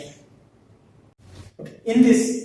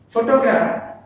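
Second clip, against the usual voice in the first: "Photograph" with "Pho" pitched higher; stress on first syllable; 5 semitones higher, 4 dB louder than usual. Shown as a demonstration. Teacher shows the class where stress falls, stress on "Pho" in "Photograph". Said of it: "the stress falls on the first syllable".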